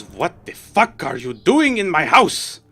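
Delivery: loud, high, slow indignant noblewoman; furious noblewoman scolding intruders; angry lady of the house. Acted. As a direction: tear into the trespassers from on high.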